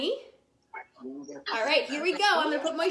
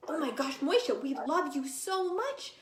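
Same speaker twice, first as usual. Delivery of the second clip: ordinary delivery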